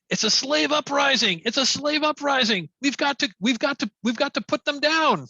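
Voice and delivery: high, urgent alarm call from an orc; rally the guards to crush the uprising; orc shouting. Acted. Game master's orc alerts the other orcs, shouting urgently. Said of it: "that orc shouts in Orcish".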